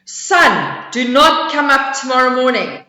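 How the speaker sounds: loud commanding bear. Acted. Bear commanding the sun, very loud voice. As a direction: order the sun with full voice. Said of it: "spoke in a very loud voice".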